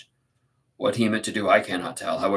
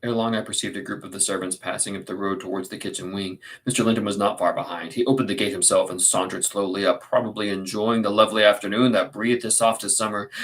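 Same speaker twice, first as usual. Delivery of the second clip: ordinary delivery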